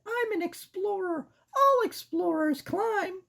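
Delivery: put-on squeaky girl mouse; high-pitched cartoon mouse. Voicing Sarah.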